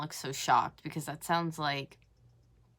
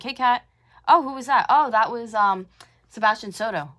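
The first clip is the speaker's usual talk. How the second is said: higher, louder imitated exchange; mock retold conversation; 5 semitones higher, 7 dB louder than usual. Commentator mimicking the storyteller greeting Kat, mock casual and high. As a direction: act out the chat in a raised voice. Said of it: "come back and be like".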